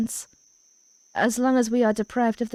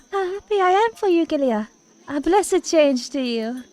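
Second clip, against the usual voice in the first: lightly voiced kindly priestess, higher and quick at first; a hint of a sweet priestess; 7 semitones higher, 3 dB louder than usual. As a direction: play a gentle priestess greeting a friend.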